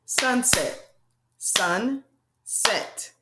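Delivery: "Sunset" split into two clapped beats, sun and set; clapping syllables. Shown as a demonstration. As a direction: beat out each syllable of "Sunset". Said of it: "Let's clap that out".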